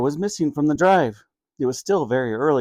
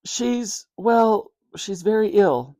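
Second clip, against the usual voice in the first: sadly; 6 semitones higher, slower than usual. Acted. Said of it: "he said sadly".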